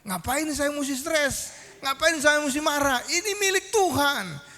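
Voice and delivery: retold realisation, high and unhurried; acted relief. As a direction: voice a sudden letting-go of stress, pitched high. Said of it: "dia langsung lega".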